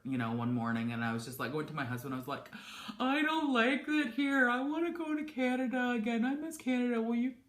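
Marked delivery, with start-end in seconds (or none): 3.0-7.3 emotional pleading. Retold or imitated. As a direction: beg like you're about to fall apart. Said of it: "'cause he knows that I'm emotional".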